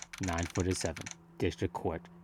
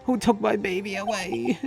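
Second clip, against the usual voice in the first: much higher, loud sobbing plea; hysterical grief; 10 semitones higher, 4 dB louder than usual. Acted. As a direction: break down, begging for answers. Larry grieving hysterically.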